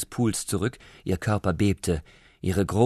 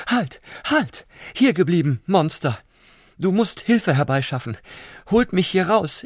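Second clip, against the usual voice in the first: much higher, urgent and loud; excited call; 8 semitones higher, 4 dB louder than usual. Acted. Prawn calling the slug back, high excited cry. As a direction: call him back in a panic. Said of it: "rief die Rote aufgeregt".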